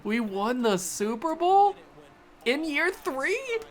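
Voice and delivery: high, slow stunned question; disbelieving. Real, not acted. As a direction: ask it in giddy disbelief. Disbelief after winning.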